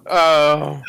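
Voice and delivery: ordinary talk, nothing marked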